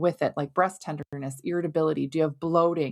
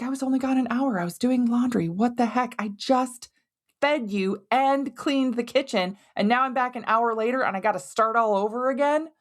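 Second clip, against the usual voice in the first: higher-pitched, acted-out exasperation; frustrated parent; 5 semitones higher than usual. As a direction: play a fed-up parent protesting to the kids. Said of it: "how frustrating it can be".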